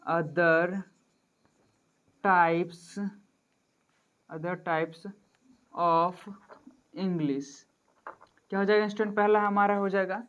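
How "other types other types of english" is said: slow dictation. Shown as a demonstration. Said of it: "हमारा other types of English".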